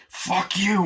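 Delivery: high, loud mock yell; acted defiance. Guy pulling a gun on the leader, acted yell. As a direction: hurl it as a defiant challenge.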